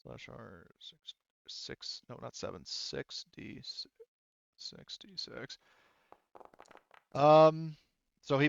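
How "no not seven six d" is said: normal talking voice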